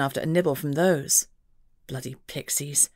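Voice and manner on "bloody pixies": much softer, lower mutter. A narrator's reading. Mother about the pixie, muttering softly. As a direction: grumble it under your breath. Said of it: "Rebecca muttered".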